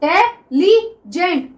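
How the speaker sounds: Intelligent slowly in four distinct beats; syllable by syllable. Shown as a demonstration. Teacher asking students to count syllables, intelligent split in four. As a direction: pause after each syllable of intelligent. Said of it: "चार बार में जाके".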